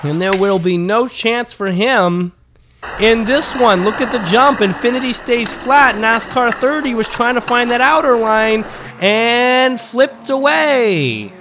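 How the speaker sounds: high, lively race call, drawing out the last words; live race play-by-play. Acted. Commentator calling a car flipping out, excited play-by-play. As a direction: call the crash with a long, falling finish.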